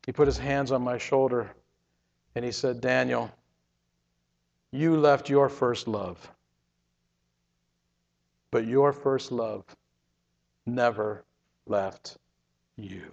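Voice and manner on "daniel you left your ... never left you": low, slow, soft, fading to near silence; tender reassurance. Retold or imitated. Jesus reassuring Daniel with hands on his shoulder, tenderly. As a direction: comfort him gently, word by word.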